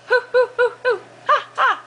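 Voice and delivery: high hooting monkey call; playful high "hoo hoo ha ha"; monkey noise. Pretend. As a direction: hoot like a monkey for a toddler. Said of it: "What does a monkey say?".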